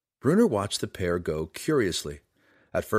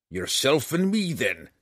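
a dwarf spitting out the words; dwarf calling out the drow; spat challenge; 4 semitones higher than usual. Acted. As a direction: sneer the dare at him. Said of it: "the dwarf spat".

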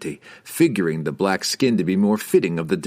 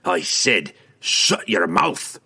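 shouting dwarf; much slower than usual. A narrator's reading. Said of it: "Bruner shouted in his face".

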